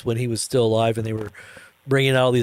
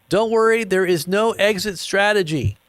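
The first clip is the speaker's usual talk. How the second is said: higher, slowed reassurance; mock calm CEO; 7 semitones higher than usual. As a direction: calmly brush off the panic. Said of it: "he goes".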